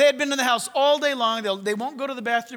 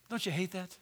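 ordinary talk

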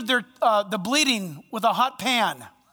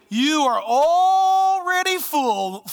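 loud, drawn-out sarcasm, higher pitched; exaggerated mockery; 4 semitones higher, 5 dB louder, much slower than usual. Shown as a demonstration. Preacher exaggerates Paul mocking the Corinthian church, exaggerated mockery. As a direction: stretch it out in grand, mocking praise. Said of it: "let me exaggerate it like this".

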